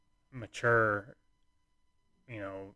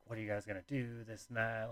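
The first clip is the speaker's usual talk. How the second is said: soft, understated imitation of a mother-in-law; retold stern question, quietly delivered; serious mother-in-law; 11 dB quieter than usual. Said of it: "being super serious mother-in-law".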